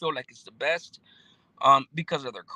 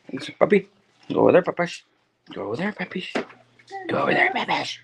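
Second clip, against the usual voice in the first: cute pet talk; 4 semitones higher, 3 dB louder than usual. Real, not acted. Streamer coaxing his pet to go over there, cute voice. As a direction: coax the pet gently in a sing-song voice.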